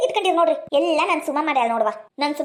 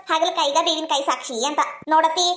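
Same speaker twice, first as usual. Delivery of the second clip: ordinary delivery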